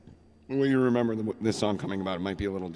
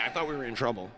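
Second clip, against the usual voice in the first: normal talking voice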